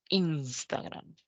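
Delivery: "Instagram" softly, stressed early with a falling end. Shown as a demonstration. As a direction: model the accepted stressing of "Instagram", falling away. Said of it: "Decimos Instagram".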